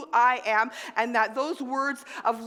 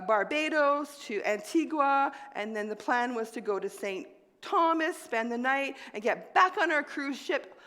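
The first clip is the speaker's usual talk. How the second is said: ordinary delivery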